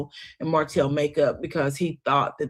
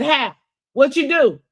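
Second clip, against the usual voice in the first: higher, louder mock question; acted startled questioning; 7 semitones higher, 6 dB louder than usual. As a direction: pounce on it, imitating his reaction. Acted startled reply to accident news.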